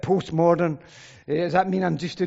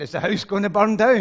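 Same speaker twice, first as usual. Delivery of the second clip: louder alarmed question from the wife; a touch of hammed-up alarm; mock panic; 2 semitones higher, 7 dB louder than usual. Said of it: "[name]'s then worrying".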